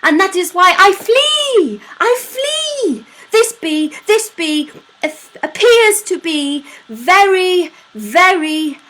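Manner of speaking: high, loud, breathless bee-poem recital; frantic poem reading. Acted. Reading a silly bee poem to Rose, high and frantic. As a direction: squeal it out as if a bee is chasing you.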